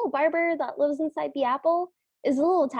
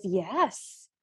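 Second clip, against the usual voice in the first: confirming gossip; 7 semitones lower, 5 dB quieter than usual. Retold or imitated. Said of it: "the other one goes".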